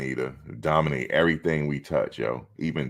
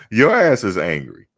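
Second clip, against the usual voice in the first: louder, punchy retort; loud comeback; 5 semitones higher, 8 dB louder than usual. Real, not acted. Shooting back at people calling him angry, loud and punchy. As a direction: fire it right back at them.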